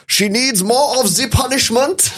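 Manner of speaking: mock German officer voice, high and slow; hammed-up German accent through a laugh. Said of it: "In a German accent".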